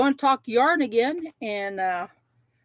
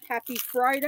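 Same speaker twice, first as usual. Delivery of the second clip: ordinary delivery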